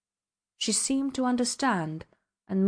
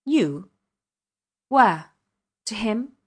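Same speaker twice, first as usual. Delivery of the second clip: sharp, louder, rising questions; piercing tone; 5 dB louder, slower than usual. A narrator's reading. Startled man demanding where she goes, piercing questions. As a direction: fire the questions at her sharply. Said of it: "asked in a piercing tone".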